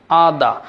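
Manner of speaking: আদা with no r at the end; British pronunciation. Shown as a demonstration. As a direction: say আদা for "other", open "ah" ending. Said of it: "রটাকে silent করে বলবো".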